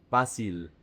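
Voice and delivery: FA stressed in "fácil", short unstressed "cil"; slow "fácil", weight on the opening "fá"; stress on first syllable. Shown as a demonstration. Teacher corrects English speakers' facile, stress on the FA of "fácil". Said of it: "The emphasis is on the F A".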